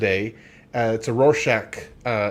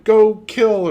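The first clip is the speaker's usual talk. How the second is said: louder, higher anime villain order; overacted anime dub; 8 semitones higher, 7 dB louder than usual. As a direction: bark it like an anime overlord. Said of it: "an anime character, but a guy who's like".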